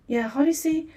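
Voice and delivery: ordinary talk, nothing marked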